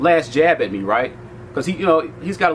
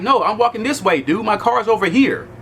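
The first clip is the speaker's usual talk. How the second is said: higher-pitched retold protest; indignant refusal; 6 semitones higher than usual. Refusing the guard's order to walk the other way, indignant retelling. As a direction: stand your ground, voice lifted.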